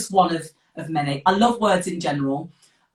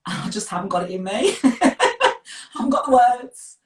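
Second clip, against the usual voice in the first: laughing through the words, higher and louder; 8 semitones higher, 6 dB louder than usual. Real, not acted. Student admitting poetry struggles to the host, laughing. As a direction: laugh helplessly at your own shortcoming.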